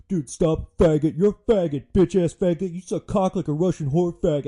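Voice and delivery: put-on loud, high drunk bro insults; drunk frat boys yelling. Drunk passengers brawling in the back seat, yelling. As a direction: hurl slurs like a rowdy drunk frat kid.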